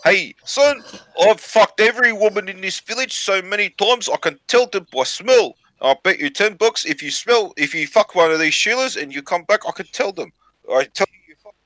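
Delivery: high-pitched put-on Maori accent; Maori chief. Chief boasting to his son, mock Maori accent. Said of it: "this Maori chief says to his son".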